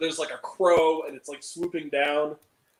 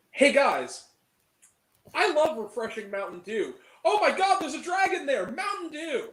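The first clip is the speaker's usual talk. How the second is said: hammed-up higher-pitched product plug; mock commercial; 6 semitones higher than usual. Reviewer parodying a soda plug to viewers, hammed-up mock commercial.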